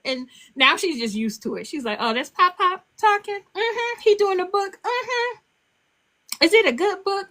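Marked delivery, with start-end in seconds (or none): 2.0-7.3 put-on child voice. Granddaughter chatting about recordings.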